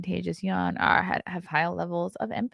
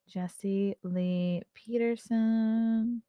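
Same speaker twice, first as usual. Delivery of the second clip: drawn-out name; 3 semitones higher, 6 dB quieter, much slower than usual. Shown as a demonstration. Reading a guest name off the list, slow and drawn out. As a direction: say the name very slowly and softly, stretching Peterson.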